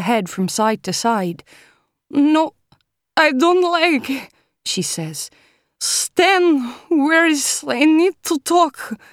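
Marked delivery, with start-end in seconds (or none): 2.1-4.3 put-on high, loud, pleading Russian girl; slurred Russian accent. A narrator's reading. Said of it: "Her words are vodka slurred, making her Russian accent unguent". 5.8-9.0 put-on high, loud, pleading Russian girl; slurred Russian accent. A narrator's reading. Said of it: "Her words are vodka slurred, making her Russian accent unguent".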